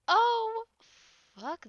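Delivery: sharp gasp, then a high loud oh; startled gasp and cry out; shocked gasp. Real, not acted. Shocked by the crying twist.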